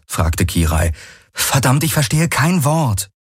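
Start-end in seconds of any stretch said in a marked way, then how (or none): 1.3-3.1 high-pitched, frustrated complaint; exasperated. Acted. Kirai cannot follow the men's talk, exasperated. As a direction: protest in exasperation, pitched well up.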